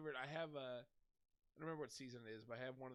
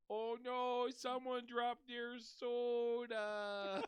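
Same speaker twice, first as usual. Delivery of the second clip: put-on loud, high drunken wail; Pickles; 8 semitones higher, 9 dB louder, much slower than usual. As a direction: drag it out very slowly in drunken dismay. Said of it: "it's Pickles drunk at".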